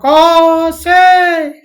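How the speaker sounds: put-on high, loud, drawn-out child's chant; imitating a child at rote recitation; child reciting. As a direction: shout it out like a schoolkid leading the class. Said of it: "एक बच्चा बोलता था".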